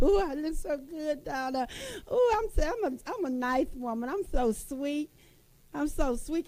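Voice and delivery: high, pleased cooing about herself; self-praise. Pretend. Woman showing sisters how to praise herself, pleased high coos. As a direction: sweet-talk yourself in the mirror. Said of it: "telling yourself you're pretty".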